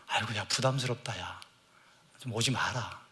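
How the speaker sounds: mildly put-off, low-key grumble; put-off reaction. Acted. Someone approached by a solemn evangelist, softly put off.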